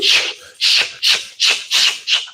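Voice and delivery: whispered shushes; soft repeated shh; hushing. Retold or imitated. Mysterious hush heard.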